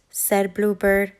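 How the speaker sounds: ordinary delivery, nothing marked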